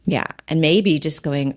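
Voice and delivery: usual speaking voice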